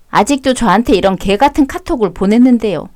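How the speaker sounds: ordinary talk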